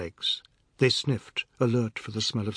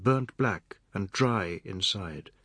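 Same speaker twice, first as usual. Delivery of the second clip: usual speaking voice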